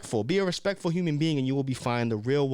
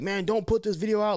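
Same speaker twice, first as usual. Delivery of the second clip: higher, mocking critic voice; 7 semitones higher than usual. Speaker mimics people warning him off the video, mocking. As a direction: imitate the doubters. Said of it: "a lot of people might be like".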